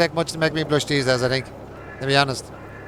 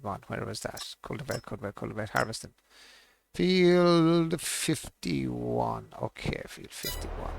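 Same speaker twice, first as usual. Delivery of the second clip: muttering to himself; 3 semitones lower, 9 dB quieter than usual. Real, not acted. Muttering to himself while playing.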